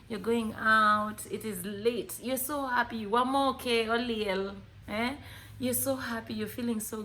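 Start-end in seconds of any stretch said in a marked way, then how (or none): none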